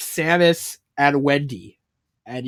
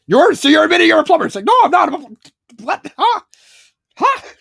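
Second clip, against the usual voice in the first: flustered denial; 13 semitones higher, 6 dB louder, faster than usual. Retold or imitated. Acted accusation and flustered denial.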